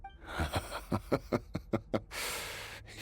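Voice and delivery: a breathy amused chuckle; soft laugh. Acted. To the swordswoman who beat him, a soft amused laugh. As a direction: laugh quietly before conceding.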